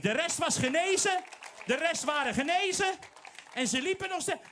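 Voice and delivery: loud, high, fervent preaching; preaching at full force. Acted. Preacher to congregation, loud fervent preaching. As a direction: drive the point home with fire.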